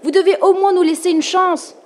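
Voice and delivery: high, loud, insistent yell; shouted demand. A narrator's reading. Boy begs the sea for a chance, high shout. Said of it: "cria Ethan".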